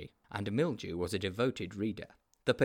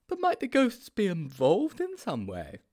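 high-pitched, naive sidekick; high storybook character voice; Sponge's character voice; 9 semitones higher, 4 dB louder than usual. A narrator's reading.

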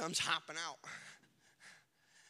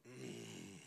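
low frustrated groan; a low groaning hum; disgusted groan; 10 semitones lower, 13 dB quieter than usual. Shown as a demonstration. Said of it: "A disgusted, frustrated groan".

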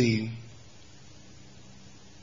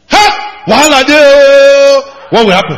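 loud exclaim; 12 semitones higher, 12 dB louder than usual. Real, not acted. Preacher dramatizing chaos.

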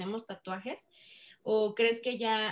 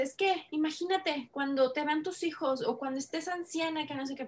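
mock classmate lecture; 6 semitones higher than usual. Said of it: "y me dice".

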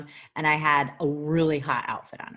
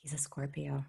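hushed; 8 dB quieter than usual. Real, not acted. Telling Ryan the killer's sign, hushed whisper. Urgent discovery while searching.

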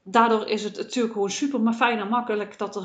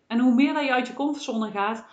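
ordinary talk, nothing marked

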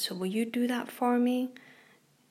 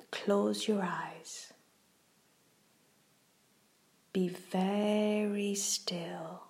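guided meditation. Acted. Reads a kids' guided meditation.

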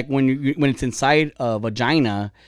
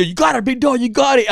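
imitated gym cheer, pitched up; spotter's encouragement; 8 semitones higher than usual. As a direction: urge on the lifter, higher than usual. Voicing a spotter cheering a lifter.

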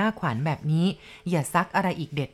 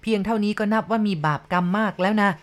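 ordinary delivery

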